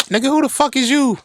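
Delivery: very high incredulous falsetto; comic, indignant high-pitched demand; acted challenge. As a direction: squeak the question in mock outrage. Acts out challenging the man.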